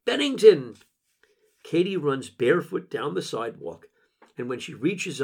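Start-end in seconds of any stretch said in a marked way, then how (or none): 0.0-0.8 high, loud call of a name; yell. A narrator's reading. Someone yelling to stop them at parting, loud high yell. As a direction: call it out from down the street. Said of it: "we hear someone yell".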